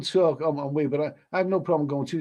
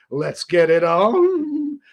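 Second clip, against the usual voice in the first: sultry come-on; 4 semitones higher, 6 dB louder, much slower than usual. Pretend. Streamer answering a flirty chatter [name], hammed-up slow seductive come-on. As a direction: stretch every word like a hammed-up crooner.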